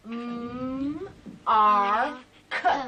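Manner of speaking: "Mm, R, K" as three separate sounds, loud on the R; syllables modelled for a child to copy; sounded out. Shown as a demonstration. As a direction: spell out "Mm, R, K" one sound at a time. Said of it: "the first syllable is mm".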